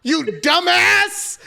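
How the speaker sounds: high, drawn-out angry tirade imitation; hammed-up furious shouting; mock yelling. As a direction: yell it like an over-the-top scolding. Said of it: "he's yelling at Charlie like".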